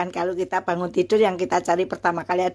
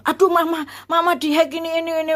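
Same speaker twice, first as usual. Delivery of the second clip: much higher, frantic alarmed cry; panicked daughter; 9 semitones higher, faster than usual. Retold or imitated. Daughter finds her mother's phone hacked, high panicked cry. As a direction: squeal it out like a scared girl who just saw the hack.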